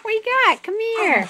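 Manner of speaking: high, loud call to a dog; excited pet talk. Real, not acted. Owner calling dog to come here, excited.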